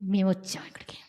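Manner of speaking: whispered revelation; secret whisper. Retold or imitated. Angels whispering to Lot why they came. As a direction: breathe it as a secret.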